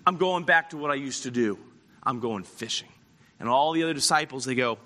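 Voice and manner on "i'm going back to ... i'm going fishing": resigned, lower and trailing down; subdued, defeated announcement; thrown off. Acted. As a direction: act out quietly giving up. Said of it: "Peter's just totally thrown off".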